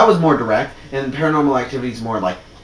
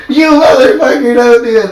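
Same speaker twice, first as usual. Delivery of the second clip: high, loud, desperate yell; yelling at a horror movie in the theater; yelling at the screen; 10 semitones higher, 9 dB louder than usual. Retold or imitated. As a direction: beg the character to stop, full voice. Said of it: "I'm like".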